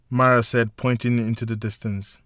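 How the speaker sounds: plain reading voice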